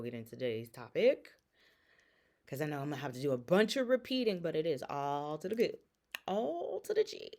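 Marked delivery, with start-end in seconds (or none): 6.3-7.3 playful high call. Pretend. Playful wordplay repeated.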